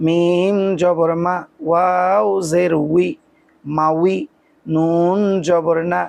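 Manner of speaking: slow syllable-by-syllable spelling; teacher spelling aloud for students; spelling drill. Shown as a demonstration. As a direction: sound out each letter slowly, then blend. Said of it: "আমরা আগে এটাকে বানান করে পড়ি".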